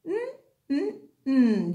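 "Mm" hummed as a long nasal mm; the n sound. Shown as a demonstration. Teacher modelling the letter N sound "Mm" for the class. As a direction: hum "Mm" for the n sound, lips closed. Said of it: "N says".

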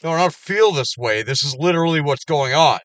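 angry outburst. Real, not acted. Venting at a so-called friend, loud and angry. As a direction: shout down the friend's excuse.

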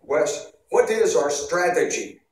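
a gruff Polish general testing his officer; Polish accent. Retold or imitated. The Polish general questioning his new officer, in a mock Polish accent. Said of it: "with his Polish accent".

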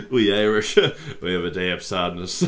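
mock-solemn quote broken by laughter; cracking up while imitating; laughing imitation. Repeats clip's line while laughing.

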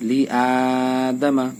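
লি আদামা with the আ after লি drawn out slowly; madd lengthening. Shown as a demonstration. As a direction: stretch only the opening আ of আদামা. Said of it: "এর আগেরটা তাড়াতাড়ি আর শেষের দুইটা তাড়াতাড়ি". (লি আদামা হামজায় টান)

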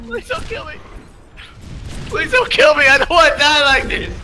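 mock pleading, high and desperate; hammed-up begging for your life; terrified horror victim. Pleading with enemy, mock terror.

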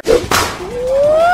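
high, drawn-out thrill-ride whoa; alarmed whoop on a drop; rising whoop. Real, not acted. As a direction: wail that climbs in pitch. Ride plunges.

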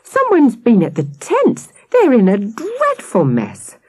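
high, agitated cry; breathless alarm. A narrator's reading. Missus Webb finding the tents wrecked, breathlessly alarmed. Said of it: "she added breathlessly".

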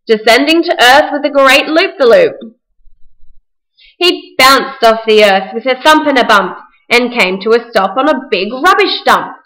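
ordinary delivery, nothing marked

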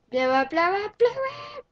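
loud rising yells of blow up; cheering the blast loudly; excited shouting. Real, not acted. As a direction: shout it on hard. Urging the explosion.

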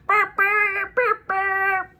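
high honking burp burp; horn honks. Acted. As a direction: honk like a truck horn. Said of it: "She presses the horn".

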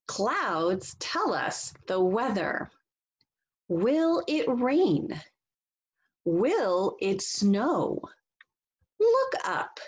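slow, sing-song storybook reading; reading to children. Acted. Teacher reading a picture book to the class, slow and expressive. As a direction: read it slowly and expressively for young listeners.

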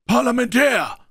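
long ringing shout on a high note; calling out. A narrator's reading. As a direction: hail them from far off. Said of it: "rief Silver".

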